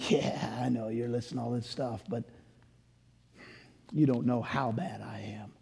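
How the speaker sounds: hushed whisper of a doubting listener; inner doubt. Retold or imitated. Doubting listener's thoughts to the pastor, whispered. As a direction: murmur it like a private fear. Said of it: "who will think to themselves".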